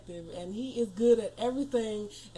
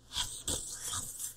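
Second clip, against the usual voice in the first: voiceless whispered gibberish; kid's secret whisper; 8 dB quieter than usual. Retold or imitated. Kid telling parent a secret, unintelligible whispering. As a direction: hiss wordless nonsense like a kid telling secrets. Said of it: "try to tell you a secret".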